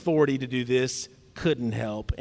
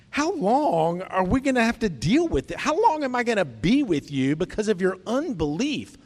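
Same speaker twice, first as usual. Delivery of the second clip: higher-pitched, exasperated complaint; frustrated; 5 semitones higher than usual. Retold or imitated. Imitating Jesus with the people, really frustrated. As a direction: act out being fed up with them. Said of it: "he's really frustrated with the people".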